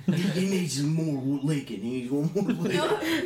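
slow, drawn-out thug voice; mock mob member. Joining in as the mob, slow mock menace. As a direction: drag it out like a hammed-up thug.

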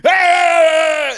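a loud, high, sustained yell; mock officer losing his temper, loud and high; sudden angry shout. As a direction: blast it at the suspect. Cop turns aggressive, retold.

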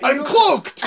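triumphant shout. Pretend. Pretending to be the eagle.

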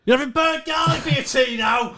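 high-pitched mock shout; mock parental yelling. Cook mock-yelling at kids about ruined tea, high screech. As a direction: screech it like an exasperated parent, hammed-up.

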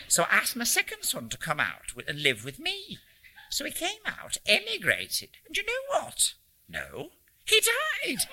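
put-on high-pitched North Country old lady; storytelling in a North Country accent, leaping up high. A narrator's reading. Old lady telling a stranger about her sons, North Country accent. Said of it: "in an English North Country accent".

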